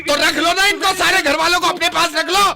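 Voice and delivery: very high, loud angry yell; shouting. Acted. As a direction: shout him down. Phone पर चीखता हुआ झगड़ा. (गुस्से में ज़ोर से चीखते हुए)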